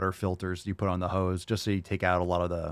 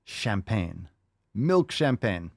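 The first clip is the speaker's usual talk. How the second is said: fancy; much slower than usual. Pretend. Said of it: "makes me feel fancier".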